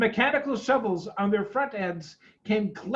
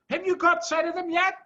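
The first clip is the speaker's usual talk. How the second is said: high, quick call across the noise; 10 semitones higher, faster than usual. A narrator's reading. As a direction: shout it over the engines. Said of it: "Bean called back".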